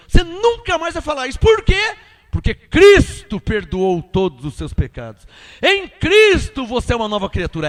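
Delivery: loud, impassioned sermon delivery; preacher punching out the key words; full-force preaching. Real, not acted. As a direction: proclaim forgiveness with force.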